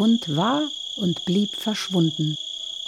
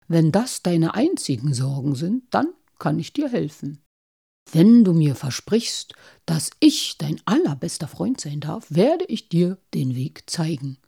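sly wolf voice; 4 semitones lower than usual. Acted. Wolf bargains quietly.

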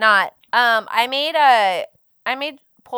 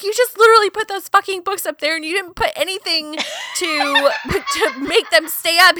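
high, louder mock outrage; imitating mad husband; 6 semitones higher, 4 dB louder than usual. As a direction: retell his rant, laughter creeping in. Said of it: "he wasn't so mad about it".

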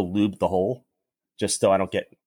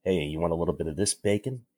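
cheesy pickup line; 5 semitones lower, 6 dB quieter than usual. Retold or imitated. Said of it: "he says shit like".

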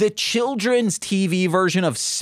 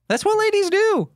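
very high put-on lady voice; mock lady voice. Joking about what ladies do, in a very high put-on lady voice. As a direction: squeak it like a prim lady.

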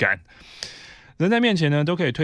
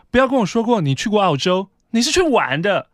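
mock condescending scolding; 8 semitones higher, 6 dB louder than usual. Coworker scolding her.

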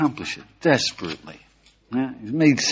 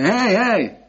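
calling after him; 9 semitones higher, 4 dB louder than usual. Retold or imitated. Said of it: "Run after him and you say".

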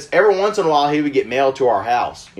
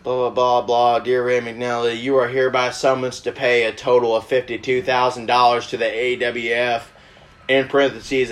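low, monotone mock-official read-out; droning through legal paperwork in a lowered voice; reading official letter; 5 semitones lower than usual. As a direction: recite it like a formal summons. Fakes reading a court summons aloud.

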